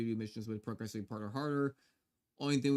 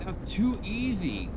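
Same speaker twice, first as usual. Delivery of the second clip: higher, slow, smug drawl; smug gloating; 6 semitones higher, 4 dB louder, slower than usual. Real, not acted. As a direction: gloat over the easy win. Repeats the taunt, gloating louder.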